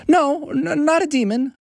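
high-pitched, stumbling denial; hasty correction. Acted. Boy correcting himself to alarmed dad, hasty. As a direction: blurt it in a high boy's voice. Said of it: "Haruo said quickly".

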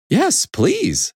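high-pitched acceptance; eager hen. A narrator's reading. Hen accepting horse's offer, eager high voice.

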